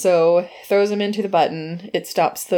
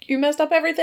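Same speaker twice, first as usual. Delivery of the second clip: high-pitched mock outrage; hammed-up high complaint; mock angry outburst; 9 semitones higher, much faster than usual. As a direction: imitate a villain's indignant whine, pitched up. Acting out the angry villain's outburst.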